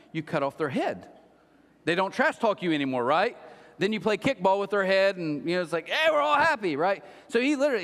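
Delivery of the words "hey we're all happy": high, loudish mock-happy shout; mock cheer. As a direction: cheer it out with fake glee. Mock cheer after gory kickball joke.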